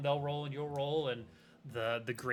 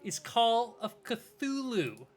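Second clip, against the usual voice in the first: singsong announcement; 8 semitones higher, 7 dB louder than usual. Real, not acted. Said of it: "singing it like that".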